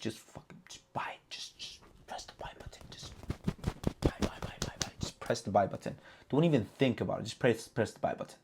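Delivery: urgent hushed whisper; breathy conspiratorial whisper urging to buy. Retold or imitated. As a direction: whisper it like a secret tip. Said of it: "I told you guys, "Listen".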